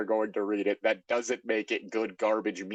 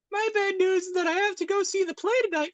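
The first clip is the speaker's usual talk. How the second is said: put-on much higher falsetto girl voice; high Amy voice. Reading Amy's line from bad fanfic, high falsetto.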